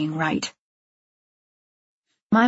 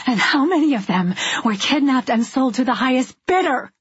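higher-pitched, heated outcry; angry raised voice, short of screaming; yelling; 5 semitones higher than usual. A narrator's reading. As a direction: hurl the question as an accusation. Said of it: "I yelled".

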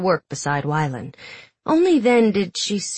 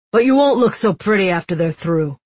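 louder taunting thug voice; menacing guard; 3 semitones higher, 5 dB louder than usual. Acted. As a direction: sneer it at the captive. Guards taunting captive girl.

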